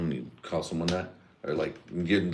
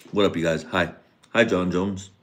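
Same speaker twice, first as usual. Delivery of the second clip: ordinary delivery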